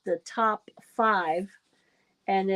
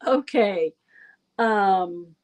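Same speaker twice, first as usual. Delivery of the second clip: ordinary talk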